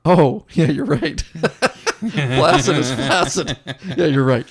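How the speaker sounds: laughing out loud at his own mistake. Real, not acted. Host realizing flaccid means not hard, laughing. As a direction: crack up while admitting the slip.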